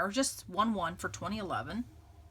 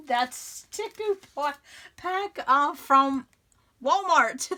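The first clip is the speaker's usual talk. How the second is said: giggling and louder, with a higher pitch; laughing; 6 semitones higher, 6 dB louder, slower than usual. Real, not acted. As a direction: laugh through the words. Laughing while naming the store.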